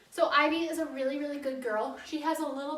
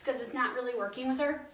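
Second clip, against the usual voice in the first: usual speaking voice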